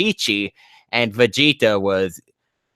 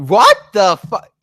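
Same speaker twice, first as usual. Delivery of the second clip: shocked; 9 semitones higher, 8 dB louder than usual. Real, not acted. Shocked reaction to fanfic text.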